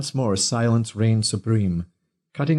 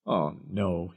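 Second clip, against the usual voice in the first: soft low groan; 3 semitones lower, 7 dB quieter than usual. A narrator's reading. Peter groaning on spotting his brother-in-law, soft groan. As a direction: groan it under the breath. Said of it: "he groaned".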